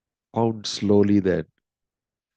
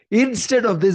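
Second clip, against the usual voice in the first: anguished plea; 13 semitones higher, 4 dB louder than usual. Retold or imitated. Queen pleads with Mohini.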